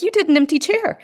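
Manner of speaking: high, laughing retold astonishment; amazed disbelief. Mother retelling her shock at son's therapy, high and amazed. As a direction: replay her stunned reaction with a laugh. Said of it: "And I'm like".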